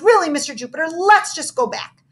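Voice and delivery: scared plea. A narrator's reading. Missy scared in dark room.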